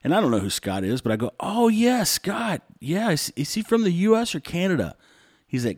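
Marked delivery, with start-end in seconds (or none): 1.4-5.0 fake eager recognition. Faking knowing Scott eagerly.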